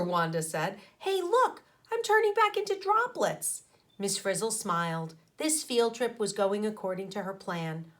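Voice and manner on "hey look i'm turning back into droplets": put-on high, loud excited girl; excited discovery. A narrator's reading. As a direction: point it out with glee. Wanda turning into droplets.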